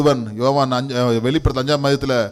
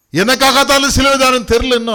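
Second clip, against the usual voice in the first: urgent preaching cry; 9 semitones higher, 5 dB louder than usual. Real, not acted.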